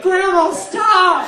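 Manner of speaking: loud, high plea to stop; kids yelling. Retold or imitated. Kids beg grandma to stop, loud and high. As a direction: shout to make grandma quit.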